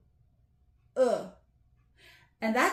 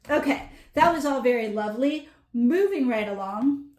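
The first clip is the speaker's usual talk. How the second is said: louder, quick cheerful sign-off; perky wrap-up; 3 semitones higher, 8 dB louder, faster than usual. Real, not acted. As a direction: breeze on, brightly and fast. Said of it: "wrap-up, teacher perks up".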